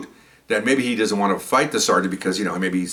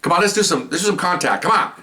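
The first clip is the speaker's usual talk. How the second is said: high, eager sparring challenge, retold; urging on; 8 semitones higher than usual. Sergeant urging the boxer into some contact, high-pitched goading. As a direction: goad him into it, pitched up.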